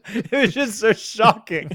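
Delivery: said slowly through laughter; can barely get it out for laughing; slow, giggling disbelief. Real, not acted. Laughing recalling joke.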